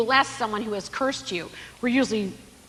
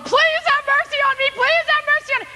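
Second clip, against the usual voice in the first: high, loud wailing plea; desperate pleading; 4 dB louder than usual. Retold or imitated. The servant begging the king for mercy, high and wailing. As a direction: beg like a man about to lose everything.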